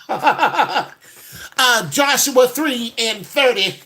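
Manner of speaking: high, slow, laughing preacher voice; preachy scripture quoting. Pretend. Church lady firing scripture at a man who greets her, high and laughing. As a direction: rattle off verses like a church lady.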